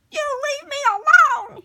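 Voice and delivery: very high, loud squeak; terrified tiny goblin squeaking. Acted. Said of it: "he squeaked".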